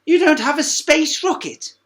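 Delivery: high, loud excited giraffe; giraffe's voice. Acted. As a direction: pipe up like a lanky friend. Giraffe voice again.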